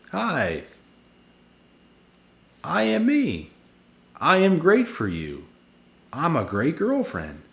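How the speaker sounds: high, sugary fake-sweet act; mocking girlfriend voice. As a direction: put on a falsely charming girlfriend. Mocks narcissist girlfriend's fake pitch.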